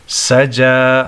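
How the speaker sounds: سجا with the final "aa" held; teacher's measured long vowel on سجا; one-alif stretch. Shown as a demonstration. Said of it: "کھڑا زبر ہے اس کو ایک الف کے برابر".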